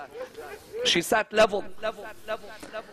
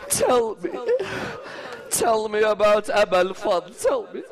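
tearful plea; 2 semitones higher than usual. Real, not acted. Hind begs Zainab about Abbas, tearful plea. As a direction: plead through real tears. Weeping while voicing Hind's plea.

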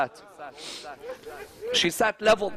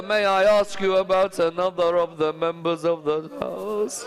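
tearful lament. Real, not acted. [name] asks Zainab about another member, tearful lament. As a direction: intone it as a grieving wail.

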